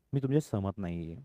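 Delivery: usual speaking voice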